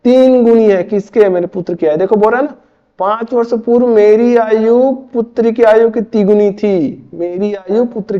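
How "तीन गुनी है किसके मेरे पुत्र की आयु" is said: ordinary talk, nothing marked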